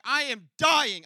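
slow dramatic plea of pain. Retold or imitated. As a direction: stretch out the mock agony. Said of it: "I'm like".

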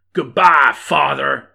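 loud, punched heroic send-off; bellowed farewell. Acted. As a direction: bellow it before pulling the trigger. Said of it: "bellowed Hardman".

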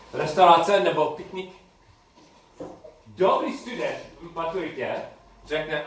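ordinary delivery

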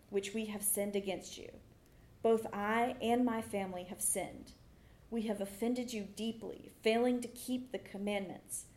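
ordinary delivery

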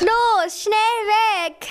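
high-pitched frightened wail. A narrator's reading. Pupsi urges everyone to flee the lion, wailing. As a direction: wail to get everyone away. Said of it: "jammerte Pupsi".

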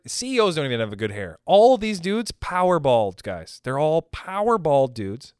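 normal talking voice

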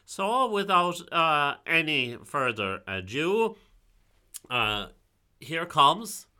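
high, grand showman intro; announcer voice. Pretend. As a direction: announce her like a host. Introducing the storyteller.

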